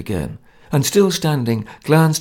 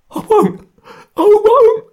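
very high, loud desperate gasps; a frightened man refusing outright; gasping refusal; 10 dB louder, slower than usual. A narrator's reading. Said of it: "he gasped".

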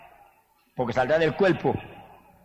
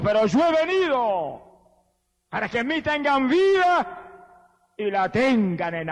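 voice of Jesus; 8 semitones higher, slower than usual. Acted. Preacher voices Jesus's words.

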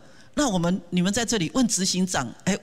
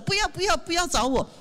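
much higher, whiny "no, no" of a shy volunteer; mock refusal; 9 semitones higher than usual. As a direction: push the offer away in a hammed-up high voice. Imitating volunteers refusing again.

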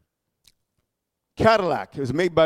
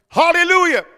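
ordinary talk

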